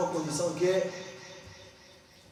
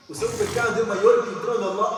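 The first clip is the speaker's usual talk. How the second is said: higher and louder, incredulous challenge; loud challenging question; 5 semitones higher, 7 dB louder than usual. Acted. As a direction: demand it loud and high in disbelief. Prophet's challenging question, voiced loud. (pergunta desafiadora, mais alta e mais forte)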